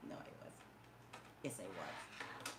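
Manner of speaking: very soft, low mock-wounded insisting; barely audible, low protest; quiet insistence. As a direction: mumble it low to yourself, playfully stubborn. Insists on being hurt after laughing.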